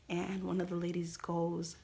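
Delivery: normal talking voice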